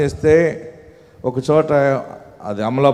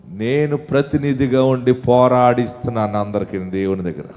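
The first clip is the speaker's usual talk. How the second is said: ordinary delivery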